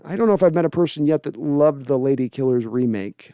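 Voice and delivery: ordinary delivery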